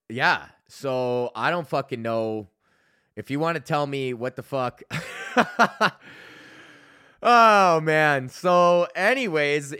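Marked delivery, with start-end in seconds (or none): 4.9-6.3 bright burst of laughter; high laugh. Real, not acted. Laid-off comic laughing after begging for advice. 7.2-9.7 much louder, higher, slow; loud exclamation. Real, not acted. Laid-off comic loudly shaking off the moment.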